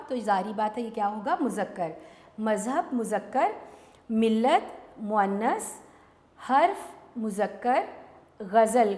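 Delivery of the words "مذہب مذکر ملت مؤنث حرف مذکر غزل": very slow, each word drawn out for copying; teacher dictating answers slowly to pupils; slow dictation. Shown as a demonstration.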